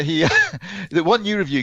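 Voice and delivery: ordinary delivery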